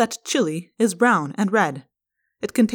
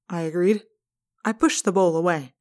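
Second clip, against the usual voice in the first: plain reading voice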